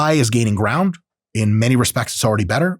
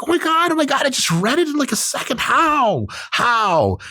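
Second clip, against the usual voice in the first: very high, squealed disbelief; mock-freakout in a high, strained pitch; hammed-up amazement; 13 semitones higher than usual. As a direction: act blown away, voice shooting way up. Acting out amazement at instant reply.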